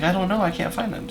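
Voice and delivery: a hint of a scared lost child; lost little girl. Lost crying girl voiced.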